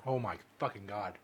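ordinary talk, nothing marked

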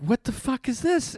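very high-pitched baffled reaction; played-up incredulous question; alarmed disbelief. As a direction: squeak out mock alarm at a baffling message. Acting alarmed reaction to angry message.

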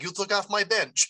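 a hammed-up Ukrainian accent on a hockey gripe. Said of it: "That was my best Ukrainian accent".

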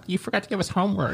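high, soft goody-two-shoes voice; mock a meek know-it-all piping up; mimicked pesky student. Classmate reminding teacher about homework, mock timid interjection.

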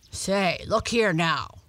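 lightly scolding dog; a bit sharply. A narrator's reading. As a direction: cut in with a touch of reproof. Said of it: "a bit sharply".

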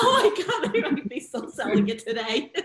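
high, loud burst of amused shock; real laughing astonishment; laughing disbelief. Real, not acted. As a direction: exclaim high, then laugh through the rest. Host reacts laughing to sales story.

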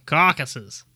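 amateur Boston accent on "Caucuses"; attempted Bostonian accent. Shown as a demonstration. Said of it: "more for, like, Bostonian".

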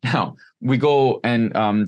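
normal talking voice

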